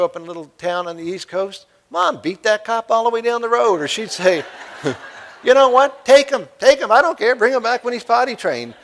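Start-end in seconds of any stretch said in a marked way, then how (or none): 5.4-8.7 higher and louder, laughing mock-careless mom. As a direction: toss the kid away with a laugh, hammed-up mother. Mom voiced in retold story.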